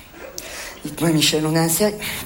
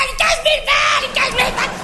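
mock hysterical yell; 10 semitones higher, faster than usual. Salesman imitating his furious boss over the stolen money, hammed-up hysterical yell. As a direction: imitate a boss losing it completely. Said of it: "sur un mode complètement hystérique".

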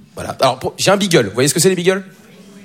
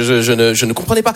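ordinary talk, nothing marked

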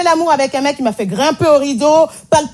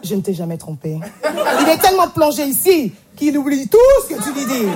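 ordinary delivery

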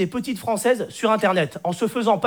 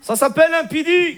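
put-on higher, blunt Moroccan-accented retort; cousin Hicham; 5 semitones higher than usual. Cousin retorting to Stéphanie, high Moroccan accent. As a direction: caricature a Moroccan accent on the punchline. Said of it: "l'accent arabe".

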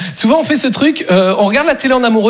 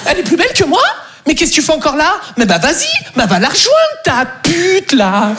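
higher, indignant mock tirade; acted jealous outrage; 6 semitones higher than usual. Girlfriend told Pauline is prettier, acted outrage. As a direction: explode in offended disbelief.

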